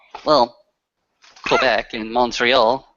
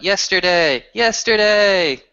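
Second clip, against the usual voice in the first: mock crowd call-out, higher pitched; crowd shout; 6 semitones higher, slower than usual. Kin shouting a song request to the banjo player, mock shout. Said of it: "Some of the kin are gonna shout".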